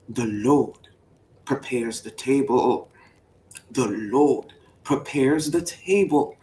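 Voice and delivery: low, slow, weighty reading; scripture read. Pretend. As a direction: lay down each word of the verse.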